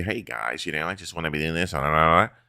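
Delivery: soft, fast, polished interview voice; lightly voiced clean-cut athlete; mock Russell TV voice. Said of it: "you know how Russell act on T V".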